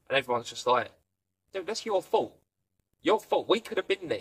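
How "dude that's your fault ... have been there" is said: mock blaming. Family blaming Lisa.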